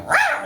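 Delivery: high-pitched cat growl; kitty cat rawr. Retold or imitated. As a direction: let out a squeaky little rawr. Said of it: "I've been doing kitty cat".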